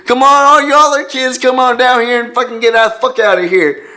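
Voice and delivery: high, loud yokel bellow. Retold or imitated. Gunman orders kids out.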